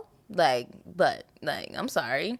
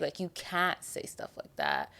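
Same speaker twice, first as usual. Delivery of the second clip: unvoiced hush to the camera; whispered aside; 5 dB quieter than usual. Real, not acted. Telling viewers what not to say, whispered. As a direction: lean in and whisper it.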